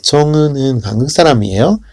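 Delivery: 사람이에요 rises sharply at the end; rising question. Shown as a demonstration. Teacher modelling Korean question intonation, quick and rising. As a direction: ask 청우는 한국 사람이에요 with a lift. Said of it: "la entonación es muy importante".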